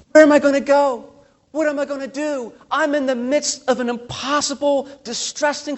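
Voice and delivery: panicked questions. Pretend. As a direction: cry out like someone trapped in trouble.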